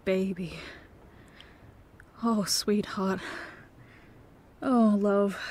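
soft sorrowful whisper; tender whisper. Acted. Whispering sorrow to his girlfriend who has been starving herself. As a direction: cradle the word. Partner hears painful admission.

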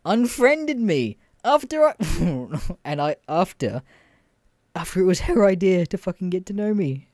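high, worked-up disbelief; indignant, pitch jumping up; salty. Real, not acted. Venting about being unmatched after her idea, salty. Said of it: "I'm just most salty about".

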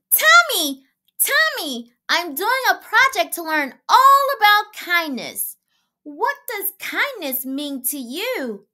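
put-on high, loud little girl calling Daddy; child calling out. A narrator's reading. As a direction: call out eagerly like a small girl.

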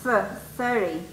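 th isolated, then thirty with a soft th; modeling the th sound. Shown as a demonstration. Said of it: "Pero es como th".

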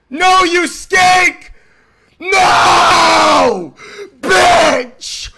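loud drawn-out angry shout; raging gamer yelling at the screen; furious yell. Real, not acted. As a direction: scream the frustration out. Losing a fight in game.

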